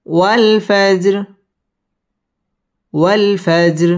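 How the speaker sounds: drawn-out melodic recitation; slow recitation. Shown as a demonstration. Teacher recites the verse for the class. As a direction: chant it slowly.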